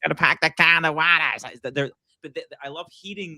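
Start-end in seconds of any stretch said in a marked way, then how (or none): 0.0-1.2 a quick imitation of a hardened Boston accent, higher; mock Bostonian, tossed off lightly. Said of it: "you could do the accent".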